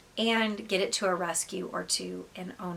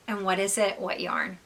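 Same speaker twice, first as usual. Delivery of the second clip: ordinary talk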